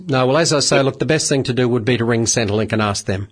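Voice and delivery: ordinary delivery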